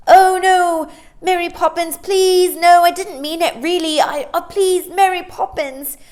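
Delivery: put-on high, loud begging child; pleading boy. Boy begging his nanny to let him stay. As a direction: plead desperately not to be sent home.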